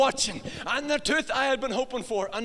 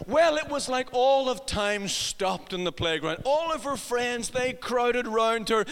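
normal talking voice

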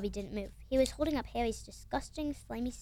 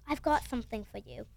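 usual speaking voice

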